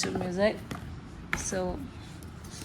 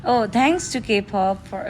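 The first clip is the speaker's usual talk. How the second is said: ordinary delivery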